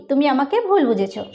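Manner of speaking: normal talking voice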